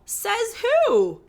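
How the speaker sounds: high, loud incredulous retort; scornful challenge. Acted. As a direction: shoot the claim down, loud and high. Challenging her own viral claim.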